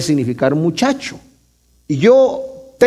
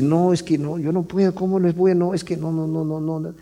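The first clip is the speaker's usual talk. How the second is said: soft refusal fading; 7 dB quieter than usual. Retold or imitated. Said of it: "Y, y, y dice".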